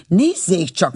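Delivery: a touch of huffy anger; angry. A narrator's reading. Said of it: "mondta Hagedorné haragosan". (enyhén haragosan)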